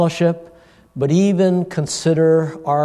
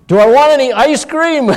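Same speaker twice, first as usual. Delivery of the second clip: loud retold question; 11 semitones higher, 7 dB louder than usual. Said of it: "My dad says".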